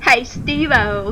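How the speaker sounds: Aussie attempt that sounds English; slow failed Australian; London-sounding Aussie attempt. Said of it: "she's come from London".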